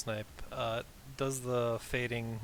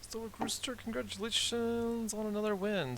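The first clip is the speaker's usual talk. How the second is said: excited win call; 9 semitones higher, 5 dB quieter than usual. Acted. Caster hypes the match winner.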